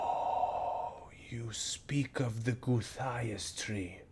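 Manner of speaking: slow, whispered dawning recognition; hushed realization. Acted. Goblin oracle recognizing the tree the party seeks, a slow whisper. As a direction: breathe it as the name sinks in.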